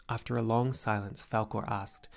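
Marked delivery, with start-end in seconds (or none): none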